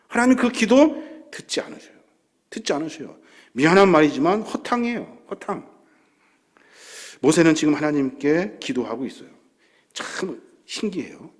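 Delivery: usual speaking voice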